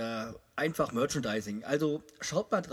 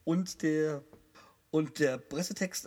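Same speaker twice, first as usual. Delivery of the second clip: ordinary delivery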